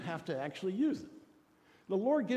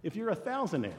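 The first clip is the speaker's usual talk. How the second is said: ordinary talk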